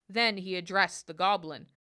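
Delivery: ordinary delivery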